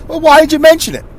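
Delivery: high-pitched mock exasperation; exasperated retort. Acted exasperated reply to teasing.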